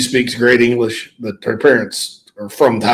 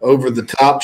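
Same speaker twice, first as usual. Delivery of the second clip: normal talking voice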